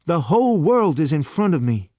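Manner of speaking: put-on young man crying out his joy; cried out. A narrator's reading. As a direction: fling it out to the world. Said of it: "Lexington cried".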